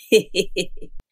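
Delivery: low, acted old man's laugh; short run of low, put-on laughter; grandpa's chuckle. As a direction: chuckle deep like a naughty grandfather.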